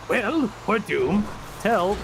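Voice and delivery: slightly high, slow character voice; lightly voiced doom, drawn out; a touch of put-on hopelessness; Winslow's mock despair. Voicing Winslow despairing in a story.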